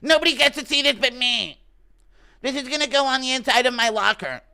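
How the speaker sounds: very high, loud put-on voice; Mikey Musumeci. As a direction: protest in a high-pitched caricature, guarding it jealously. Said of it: "Impression of Mikey Musumeci not wanting to show Conor's lifting weights pic".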